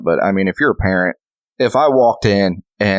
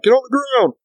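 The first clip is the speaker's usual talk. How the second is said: high barked command; retold police shout; mock cop yell. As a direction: yell it like a cop making an arrest. Cop yelling arrest order.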